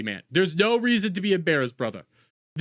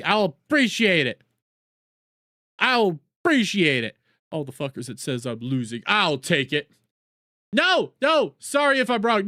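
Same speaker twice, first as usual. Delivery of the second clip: normal talking voice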